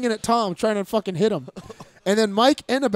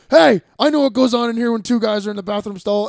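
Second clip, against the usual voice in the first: bouncer yell; 3 semitones higher, 8 dB louder than usual. Retold or imitated. Bouncer busting into the stall.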